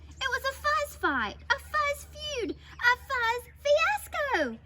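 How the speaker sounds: put-on high, punchy children's storytelling; excited story narration. A narrator's reading. Narrator tells children of fuzz war, excited. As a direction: build a battle with relish, word by word.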